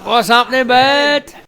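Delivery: high, loud and lively hail; loud called-out greeting. Real, not acted. Co-host greeting the guest, loud and high. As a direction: call out a big matey hello.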